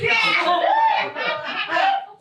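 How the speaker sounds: high, loud crazed cackle; mad cackle. Acted. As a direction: cackle like a deranged mother over her prize. Mother gloating over the pouch.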